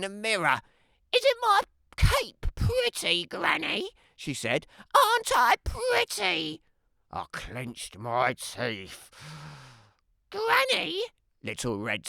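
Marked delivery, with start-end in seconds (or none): 1.1-3.9 put-on much higher, sing-song girlish voice; a sweet, self-admiring child's voice; vain little girl. Little Red voiced by narrator. 4.9-6.6 put-on much higher, sing-song girlish voice; a sweet, self-admiring child's voice; vain little girl. Little Red voiced by narrator. 10.3-11.0 put-on much higher, sing-song girlish voice; a sweet, self-admiring child's voice; vain little girl. Little Red voiced by narrator.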